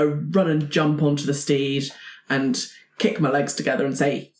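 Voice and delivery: ordinary delivery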